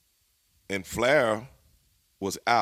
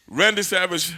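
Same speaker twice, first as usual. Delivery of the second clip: usual speaking voice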